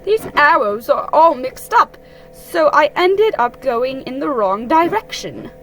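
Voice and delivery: explaining Owl. A narrator's reading. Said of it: "said Owl".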